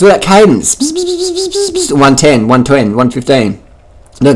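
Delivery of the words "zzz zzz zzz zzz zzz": high, soft buzzing "zzz" like a turbo winding up; turbo spooling buzz. Retold or imitated. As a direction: buzz through your teeth like a spooling turbo. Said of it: "spooling the turbos".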